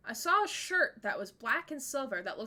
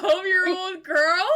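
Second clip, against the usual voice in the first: shocked disbelief; 7 semitones higher, 12 dB louder, slower than usual. Real, not acted. Shocked reaction to a question.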